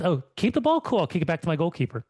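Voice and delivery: high, sarcastic fake-cheery player; mock-cheerful high voice, a touch fast. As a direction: send it up like a clueless player. Mocking the dumb coaching advice.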